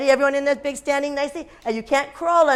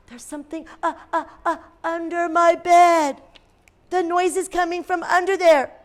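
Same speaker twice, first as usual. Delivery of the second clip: scared stammer; 7 semitones higher than usual. A narrator's reading. Scared child hears noise under bed.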